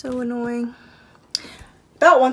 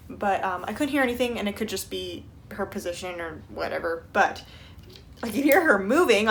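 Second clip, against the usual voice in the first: ordinary talk, nothing marked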